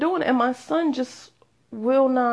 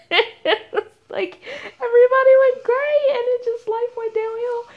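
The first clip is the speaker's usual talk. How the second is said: high, squeaky laughing speech; talking through laughter; 13 semitones higher, 5 dB louder than usual. Real, not acted. Podcaster joking about life going downhill at thirty, laughing. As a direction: struggle to talk while cracking up. Cracks up while telling it.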